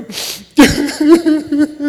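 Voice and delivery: loud, high blubbering; mock sobbing. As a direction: imitate breaking down in sobs. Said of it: "you're a little wimping here".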